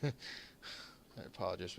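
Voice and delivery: quiet, choked-up apology; holding back tears. Real, not acted. Streamer thanking donors for his charity stream, choked up. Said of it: "I'm kinda getting choked up here".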